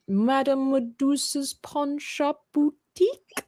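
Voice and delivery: slow villainess voice, lightly done; a hint of a cartoon villainess; Madame Medusa voice. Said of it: "I tried to do it in her voice".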